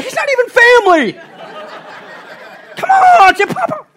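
very high, loud mock outrage; exasperated shouting. Father pleading with his baby to say Papa, exasperated yelling. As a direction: yell it like a comically jealous dad.

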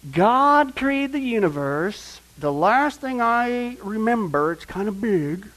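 drawn-out words, starting high and settling; slow musing. Acted. Preacher recalling that God made the universe, slow musing. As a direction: stretch each phrase as if pondering it. Muses aloud on God's bigness.

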